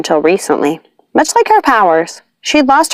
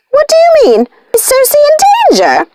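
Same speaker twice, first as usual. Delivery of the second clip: very high, louder panicked voice; panicked witch; 3 dB louder than usual. A narrator's reading. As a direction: cry out in alarm for Circe. Sisters panicking about Circe.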